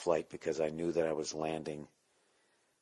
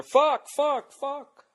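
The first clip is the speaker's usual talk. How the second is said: screamed high curses at the gear; swearing rant; 8 dB louder, much slower than usual. Retold or imitated. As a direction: lose it at the broken mixer, high and loud. Mock rant at the mixer.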